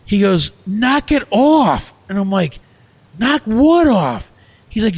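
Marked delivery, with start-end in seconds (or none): 0.7-1.9 loud, high and drawn out; stretched-out, raised-voice reprimand; retold angry shout. Acted kitchen argument, employee snaps back. 3.2-4.3 loud, high, drawn-out challenge; re-enacted heated comeback; retold yelling back. Acted argument, boss yells back.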